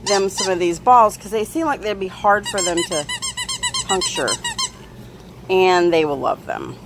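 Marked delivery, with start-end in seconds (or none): 2.9-4.3 squeaky word. Real, not acted. Sudden squeak on one word.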